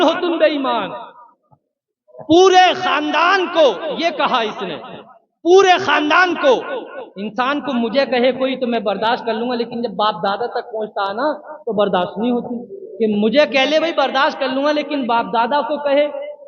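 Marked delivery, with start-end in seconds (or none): none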